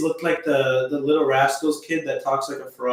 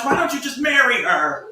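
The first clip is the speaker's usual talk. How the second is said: playful shout, pitched up; teasing shout; 7 semitones higher than usual. Real, not acted. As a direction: yell it as a tease.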